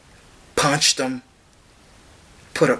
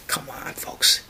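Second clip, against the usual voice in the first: whisper; 5 dB quieter than usual. Real, not acted. Whispered appeal.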